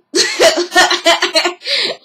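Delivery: mocking laugh.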